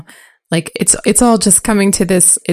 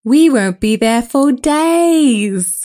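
put-on higher, slow girl's voice; lightly voiced girl; 4 semitones higher, slower than usual. Girl telling the boys the trip is long, slowly. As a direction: drag out the bad news. Reads Arya's lines.

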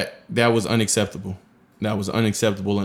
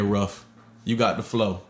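ordinary delivery

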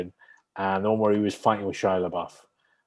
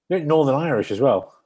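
ordinary talk, nothing marked

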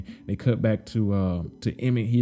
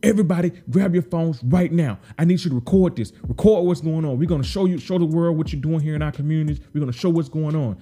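higher and louder urgent call; amped-up rallying shout; 5 semitones higher, 3 dB louder than usual. Retold or imitated. Trigg urging the crowd to record, louder higher urgent shouting. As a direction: get everyone recording now. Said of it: "He's like".

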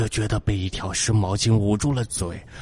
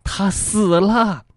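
slow, much higher, uncanny flat pronouncement; wife stares fixedly and announces the death; eerie stare; 13 semitones higher, 4 dB louder, slower than usual. A narrator's reading. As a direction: deliver it slow and unsettling, like a ghost. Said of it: "眼神直直地看着我说".

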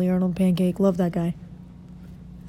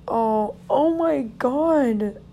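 higher and very slow, dismayed; dismayed oh my God; 6 semitones higher, much slower than usual. Real, not acted. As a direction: draw it out in disbelief. Remembering the dead hamster picture.

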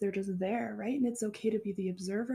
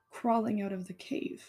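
normal talking voice

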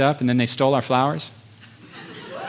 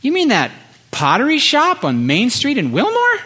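high, amazed disbelief; can't believe what he's hearing; incredulous question; 8 semitones higher than usual. Retold or imitated. The preacher answering the Lord's odd order in disbelief.

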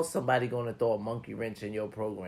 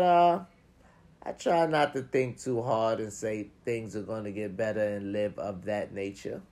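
ordinary talk

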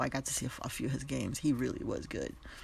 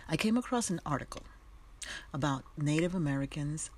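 ordinary talk, nothing marked

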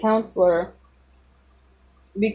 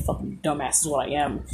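self-mocking mutter; 7 semitones lower, 10 dB quieter, faster than usual. Real, not acted. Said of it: "I'm a freaking genius. Wow".

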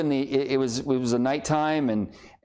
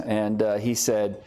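ordinary delivery

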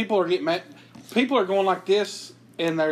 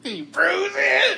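high, very slow, laughing yell; exaggerated repeat; 12 semitones higher, much slower than usual. Relishing a joke's punchline, high drawn-out cry. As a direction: stretch the punchline right out.

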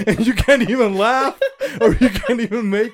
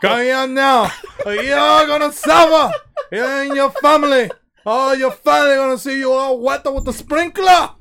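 hammed-up tyrant gloating over the family; mock Kim Jong-un threat; 6 semitones higher, 6 dB louder than usual. Shown as a demonstration. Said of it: "what do you say?".

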